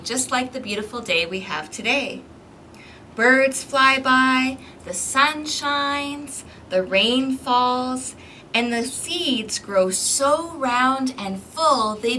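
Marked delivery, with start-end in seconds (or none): 3.1-11.9 slow. Acted. Spring scene read slowly.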